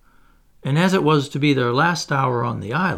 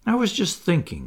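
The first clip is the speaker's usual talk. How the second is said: high-pitched, mildly timid admission; a touch of nervousness, pitched up; a little scared; 6 semitones higher than usual. A narrator's reading. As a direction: own up timidly. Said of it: "a little scared".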